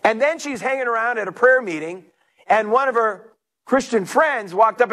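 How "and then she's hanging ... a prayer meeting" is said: normal talking voice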